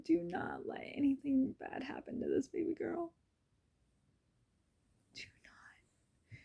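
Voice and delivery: choked, whispered plea; tearful whispered prayer. Retold or imitated. Praying over the baby, whispered and choked up. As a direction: pray it in a broken whisper. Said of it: "I was like, "Lord God".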